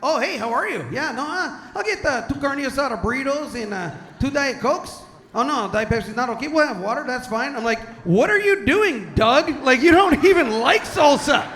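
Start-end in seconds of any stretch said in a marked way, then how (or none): 0.0-7.5 higher-pitched imitated Spanish accent; breezy restaurant order in a put-on Spanish accent. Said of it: "flip the switch". 8.0-11.5 loud, high mock outrage; hammed-up exasperation, pitched up; exasperated scolding. Acted scolding of dad.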